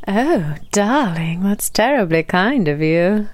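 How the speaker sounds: slow, mannered mock-British endearment; British accent. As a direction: play at being terribly British, drawn out. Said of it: "how to say in a British accent".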